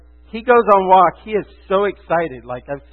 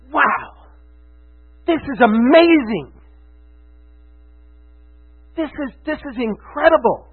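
raised pitch, marveling, with pauses; awestruck amazement; 4 semitones higher than usual. Acted. Preacher voicing a listener's moment of revelation, awestruck. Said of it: "these moments where it's like".

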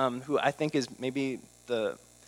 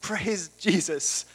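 slow, higher, hammed-up hallelujah; slowed mock praise of a shallow believer; 5 semitones higher, much slower than usual. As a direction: parody empty cheering. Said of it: "it's not like".

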